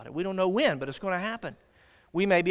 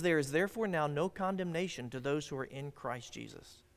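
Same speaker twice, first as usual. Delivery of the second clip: softer read verse; 4 semitones lower, 8 dB quieter than usual. Retold or imitated. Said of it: "the Bible says".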